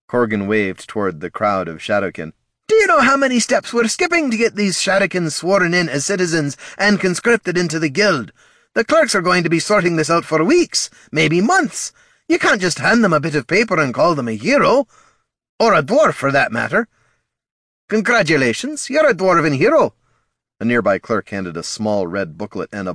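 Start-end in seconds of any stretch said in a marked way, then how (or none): 2.7-16.9 higher, louder grumbling dwarf; exasperated dwarf guildmaster. A narrator's reading. Corgan's exasperated speech. 17.9-19.9 higher, louder official pronouncement; rubber-stamp the hero line; brisk clerk announcement. A narrator's reading.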